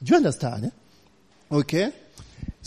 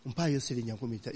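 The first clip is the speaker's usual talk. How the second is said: lady's quick plea; 6 semitones lower, 12 dB quieter, much faster than usual. Retold or imitated. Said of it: "the lady was like".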